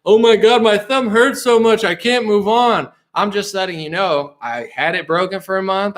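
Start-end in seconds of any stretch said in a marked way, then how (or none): 0.1-2.9 mock crying. Streamer mocking complaints about a broken thumb, mock crying. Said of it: "It's not like I'm crying".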